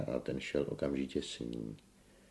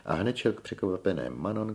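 plain reading voice

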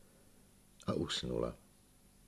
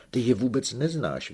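higher, louder protesting cry; indignant outburst from a young man; 8 semitones higher, 6 dB louder than usual. A narrator's reading. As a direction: fling it back at him in protest. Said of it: "vykřikl Igolén".

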